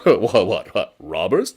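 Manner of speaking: steady and calm against the panic; calm noodle seller. Acted. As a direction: noodle seller calmly asking what is wrong.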